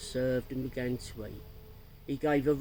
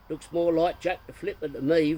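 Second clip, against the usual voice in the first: mild mock roar; 4 semitones higher, 6 dB louder than usual. A narrator's reading. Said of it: "roared Geoffrey Child's dad".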